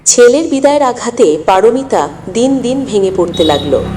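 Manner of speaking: ordinary delivery, nothing marked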